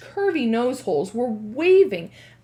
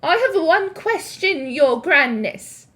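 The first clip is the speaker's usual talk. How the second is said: witch voice; 6 semitones higher, 7 dB louder than usual. Acted. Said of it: "said a voice from the audience".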